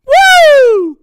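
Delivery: loud, high shouts of greeting; thrilled yelling to welcome Jen; excited shouting. Real, not acted. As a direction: cheer her name across the room. Greeting mum in the chat.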